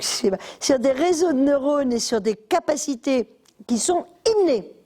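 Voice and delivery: ordinary talk, nothing marked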